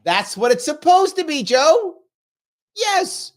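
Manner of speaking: high, loud insistence; emphatic agreement. Real, not acted.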